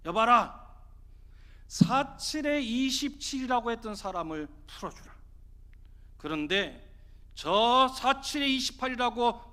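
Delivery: loud commanding proclamation; magistrate's verdict. Acted. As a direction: pass sentence with ringing authority. 사또가 판결을 내림. (사또가 호령하듯 판결)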